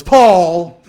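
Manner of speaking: high, loud delighted shout; gleeful shout. Retold or imitated. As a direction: yell the name in triumph. Martin shouts the corrected name.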